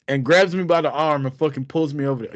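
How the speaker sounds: ordinary delivery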